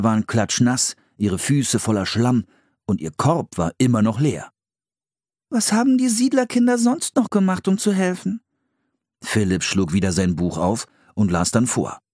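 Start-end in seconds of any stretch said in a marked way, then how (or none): 5.5-8.4 put-on a girl still giggling over the poor eel; girl voice, laughing pity. A narrator's reading. Anne to her brother after falling in, high and amused.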